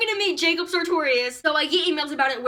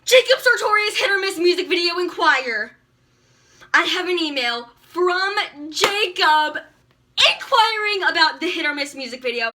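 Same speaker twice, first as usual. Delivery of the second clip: excited reading; 4 semitones higher, 7 dB louder than usual. Real, not acted.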